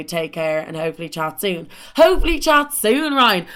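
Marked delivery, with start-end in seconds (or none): 2.0-3.5 higher and louder, scornful mimic; sarcastic echo. Mocking the ex's goodbye text, scornful and loud. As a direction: spit his line back mockingly.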